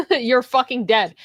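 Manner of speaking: usual speaking voice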